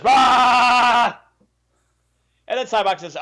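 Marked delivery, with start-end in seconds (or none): 0.0-1.2 high loud hammed-up yell; mock battle cry. Vulcan Jesus charging the fake God, hammed-up yell. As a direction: throw in a cartoon war cry. Sybok attacks Pseudo God.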